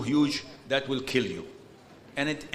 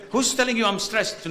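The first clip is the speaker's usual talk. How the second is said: higher, mildly raised mock protest; indignant re-enacted retort, pitched up; retold shouting; 6 semitones higher, 4 dB louder than usual. Husband told to calm down by his wife, mock shouting. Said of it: "No, you're shouting".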